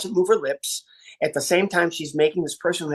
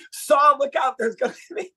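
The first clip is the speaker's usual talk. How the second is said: mock alarm; 8 semitones higher than usual. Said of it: "panicked crowd".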